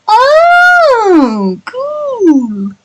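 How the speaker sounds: very high, drawn-out mock-impressed squeal; hammed-up awe. Reader recalling their reaction to Dante, exaggerated high-pitched awe. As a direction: stretch it high, then let it slide down.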